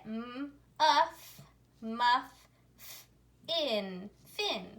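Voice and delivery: "M, uff, muff, f, in, fin" as separate sounds, then blended; phonics-style blending of "M, uff, muff, f, in, fin" with pauses; sounding out. Shown as a demonstration. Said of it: "So let's read it".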